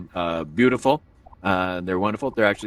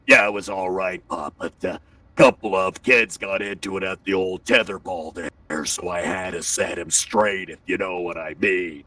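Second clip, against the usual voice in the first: tough-guy son; 4 semitones lower than usual. Retold or imitated. Son tells dad about tetherball fight, tough swagger. Voices his very strong son.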